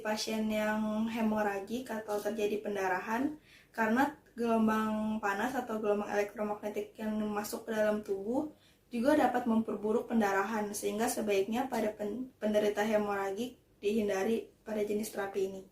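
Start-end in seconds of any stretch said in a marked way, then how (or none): none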